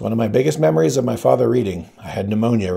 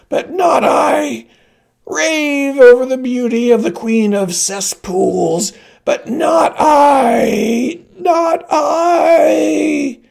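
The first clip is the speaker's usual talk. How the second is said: very high, slow, wailing shout; crying shout; 6 dB louder, much slower than usual. Acted. Old woman defying the queen, a very high crying shout. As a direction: break into a sobbing scream of defiance.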